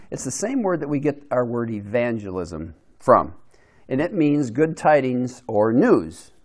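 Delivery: ordinary talk, nothing marked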